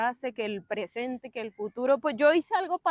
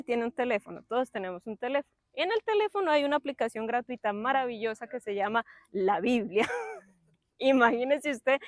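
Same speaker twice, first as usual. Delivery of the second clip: normal talking voice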